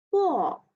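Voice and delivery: "bo" falling sharply; fourth tone. Shown as a demonstration. Teacher drilling the fourth tone of "bo". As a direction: drop "bo" hard from high. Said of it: "Fourth tone".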